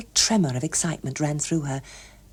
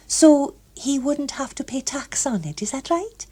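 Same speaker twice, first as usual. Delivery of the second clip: put-on higher, eager girlish voice; a young woman trying to sound poised; lightly voiced eager Gloria; 8 semitones higher than usual.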